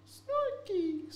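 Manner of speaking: name squeaked way up high; squeaky silly name. Pretend. Repeating a funny character name.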